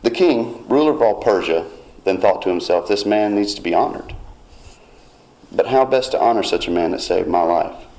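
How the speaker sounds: ordinary talk, nothing marked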